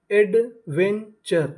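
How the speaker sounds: Adventure stretched out very slowly, falling at the end; slow drawn-out model word. Shown as a demonstration. Teacher to students, blending adventure slowly. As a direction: spell out adventure slowly and deliberately. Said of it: "तो meaning बनी".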